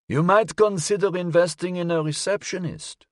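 high-voiced old gentleman; elderly man. A narrator's reading. Elderly caller advising the detective, old man's high voice. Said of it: "sounded as if it belonged to an elderly man".

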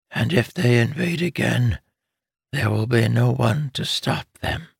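lightly voiced pleading mother; weak urgent mother. Dying mother urging her son to leave, weak. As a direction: urge him though strength fades.

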